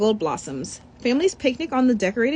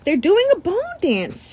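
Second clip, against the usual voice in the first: high, lively call; a boy thrilled by the dance; mild excited yell; excited shout; 8 semitones higher than usual. Acted. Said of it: "shouts Beto".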